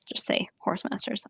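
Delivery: soft whispered aside. Real, not acted. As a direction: whisper it to the listener. Aside about changed wording.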